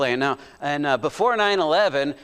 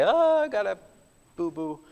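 put-on whiny hurt kid; 2 semitones higher than usual. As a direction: play the little boy crying to Mom. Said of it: "go cry to Mom and say".

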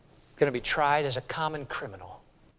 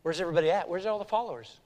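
ordinary talk, nothing marked

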